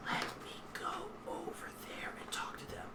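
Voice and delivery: whispered under the breath, barely audible; very quiet whisper. Acted. Sneak telling partners he'll go over, whispering. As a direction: whisper it so only your partners hear. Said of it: "quiet when he talks".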